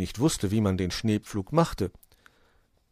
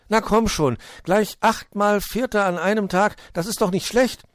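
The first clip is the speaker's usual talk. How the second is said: hohe, laute, aufmunternde Vogelstimme; überredender kleiner Vogel; 12 semitones higher, 6 dB louder than usual. Acted. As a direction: rede als kleiner Vogel munter gut zu. Zwitschi coaxes Borstel. (gut zuredender kleiner Vogel)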